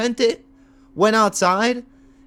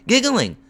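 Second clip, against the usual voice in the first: high mock giggle; mimicked giggling; 6 semitones higher than usual. Streamer mimicking the man laughing on the phone, mock giggling. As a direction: imitate his laugh, pitched up and drawn into giggles. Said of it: "And he's laughing. He's like".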